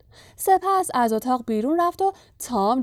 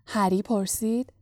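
plain reading voice

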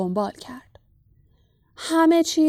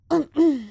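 loud, pointed throat clearing; 6 semitones higher, 3 dB louder than usual. A narrator's reading. Minister clears his throat loudly before leaving. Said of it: "فاج با صدای بلندی گلویش را صاف کرد".

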